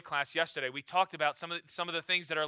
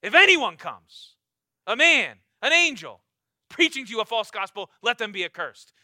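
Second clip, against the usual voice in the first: emphatic repeat; 2 semitones higher, 8 dB louder than usual. Acted. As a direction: repeat it harder so nobody misses it. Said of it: "let me repeat myself".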